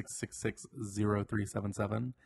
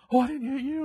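much higher and louder, relieved mock driver; 8 dB louder than usual. Driver learns the walker was not hit, relieved and much higher. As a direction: gasp it out with sudden relief.

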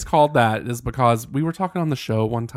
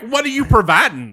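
shouting back; 9 semitones higher, 6 dB louder than usual. Acted. Mock fight, shouting back.

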